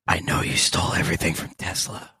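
low breathless secret whisper. Pretend. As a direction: whisper it into a dying man's ear. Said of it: "whisper into Thomas Edison's ear".

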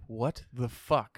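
slow, drawn-out disbelief; mock baffled. Friend imitating confused Spider-Men in slow disbelief. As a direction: stretch it like a stunned hero. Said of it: "they're gonna be like".

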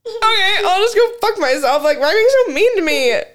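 high laughing half-cry, hammed-up hurt; fake-sob it up high through laughter; mock whimper. After the worker slams the window, mock hurt whine.

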